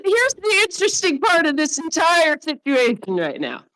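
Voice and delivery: ordinary talk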